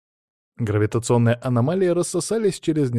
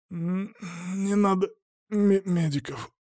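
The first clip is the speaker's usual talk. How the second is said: weak, halting croak, higher and softer; hoarse croak; 8 semitones higher, 5 dB quieter, slower than usual. A narrator's reading. Injured boy refuses medics, hoarse and strained. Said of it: "прохрипел Антон".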